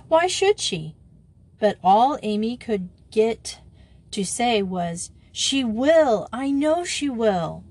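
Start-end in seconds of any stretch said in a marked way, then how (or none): 0.1-0.9 high-pitched indignant question; challenging question. A narrator's reading. Margia dismissing Amy's fear, challenging. 5.3-7.6 high, drawn-out insistence; anxious insistence. A narrator's reading. Amy dreading Grisel's laughter, insisting anxiously. All Amy could get to say.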